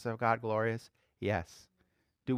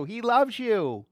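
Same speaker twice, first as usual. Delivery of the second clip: usual speaking voice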